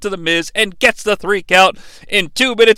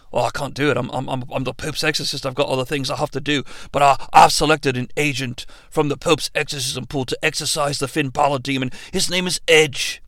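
low exorcist voice; 8 semitones lower, 7 dB quieter than usual. Pretend. Crowe character answers Pope, lower.

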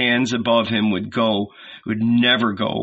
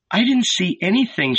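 higher, mildly plaintive; despairing murmur; 9 semitones higher than usual. A narrator's reading. Mary shakes her head to Salome, despairing. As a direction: lament it in a raised pitch. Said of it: "she murmured".

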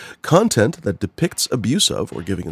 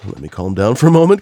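ordinary talk, nothing marked

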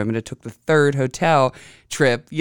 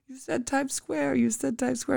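high, softer taunting voice; mocking whiny repeat; 12 semitones higher, 5 dB quieter than usual. Husband blaming her for saying Times Square, mocking. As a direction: mimic him repeating it back. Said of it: "He's like".

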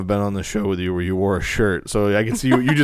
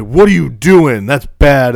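slow, louder scolding of a dog; stern scolding; 3 semitones higher, 10 dB louder, much slower than usual. Retold or imitated. Owner scolding the dog in a stern voice. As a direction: tell the dog off slowly. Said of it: "I always love those videos where people are like".

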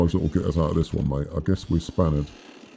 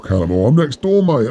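calling back; 7 semitones higher, 5 dB louder than usual. Acted. Answering call through wall.

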